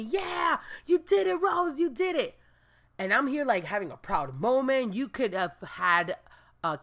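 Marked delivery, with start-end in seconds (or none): 0.0-2.4 high cheering voice. Retold or imitated. Property manager and guard cheer her for catching the rat, high cheer. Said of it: "started cheering me".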